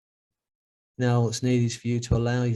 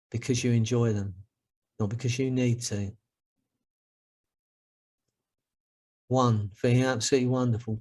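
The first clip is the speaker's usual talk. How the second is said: guided hypnosis; 4 semitones lower than usual. Acted. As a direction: count them gently out of trance. Hypnosis session count-up.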